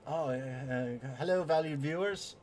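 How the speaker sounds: hammed-up British YouTuber greeting; mock British host greeting his audience; Cap impression, British accent. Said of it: "you should talk in a British accent".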